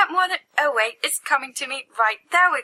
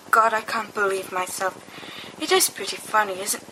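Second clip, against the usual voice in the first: ordinary talk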